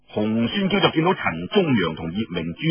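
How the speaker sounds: ordinary delivery, nothing marked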